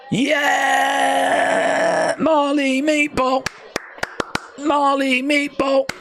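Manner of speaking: high, shouted cheer for her fighter; excited cheer. Real, not acted. Fan cheering Marley Meatball's walkout, excited shout.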